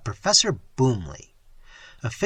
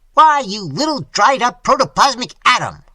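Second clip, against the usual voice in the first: loud, much higher furious insult; bursting out in passion; 9 semitones higher, 8 dB louder than usual. A narrator's reading. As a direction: explode at him. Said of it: "burst out Boomley, his face suffused with passion".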